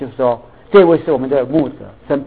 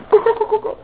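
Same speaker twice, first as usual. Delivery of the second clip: clacking noises. Retold or imitated. Imitating clacking again.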